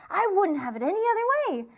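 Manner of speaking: eager, high and loud; excited. Acted. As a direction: burst out with delight. Man eagerly shaking hands.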